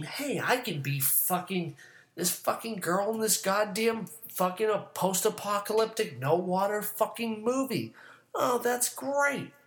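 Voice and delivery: high, sarcastic mock enthusiasm. Host imitating whiners about movie characters, high and sarcastic. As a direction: gush in a high mocking voice. Mocking the complainers in their voice.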